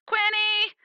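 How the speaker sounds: high call from afar, searching; yelling a name. Acted. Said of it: "someone very familiar yelling".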